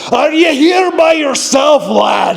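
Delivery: put-on high-pitched yell in a thick Scottish accent. Scottish father yelling to a stranger on the trail, thick accent. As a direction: call out like a Scotsman over the wind. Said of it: "yells at me in this very thick Scottish accent".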